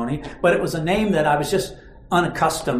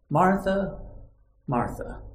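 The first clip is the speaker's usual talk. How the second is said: gentle; 7 dB quieter, slower than usual. Acted. Jesus gently addressing Martha.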